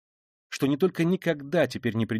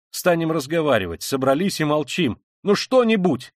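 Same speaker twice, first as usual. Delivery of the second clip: high, loud urging; raised voice, prodding everyone to talk; exasperated demand; 5 semitones higher, 5 dB louder than usual. A narrator's reading. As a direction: build to an impatient cry. Exasperated demand that they all talk.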